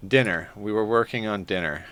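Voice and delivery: ordinary talk, nothing marked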